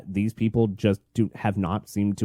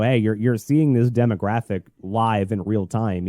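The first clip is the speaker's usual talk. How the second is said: normal talking voice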